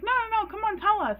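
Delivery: high-pitched, eager coaxing; insistent pleading. Retold or imitated. Friends pressing him to tell, high and insistent. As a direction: beg them to spill it. Friends begging to hear the story.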